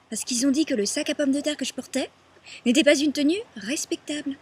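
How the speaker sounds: high, loud, scornful outrage; indignant protest. Acted. Woman telling why they arrested her sack-clad self, indignant.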